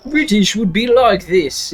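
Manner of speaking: British accent. Shown as a demonstration. Streamer demonstrating British for the meow request, mock British accent. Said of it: "so British".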